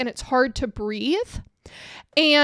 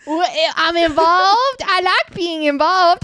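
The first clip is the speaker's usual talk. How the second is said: voicing a dopey boy; 5 semitones higher, 6 dB louder than usual. The dummy answering Auntie Gina, goofy high voice. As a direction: play the grinning dummy, dragged out high. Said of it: "He's like".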